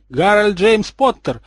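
high, loud, drawn-out full-name scolding; angry yell. A narrator's reading. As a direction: bellow the name slowly in outrage. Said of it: "второй разгневанный вопль".

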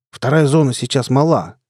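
plain reading voice

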